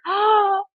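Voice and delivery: gasp. Real, not acted. Falls in love with coffee.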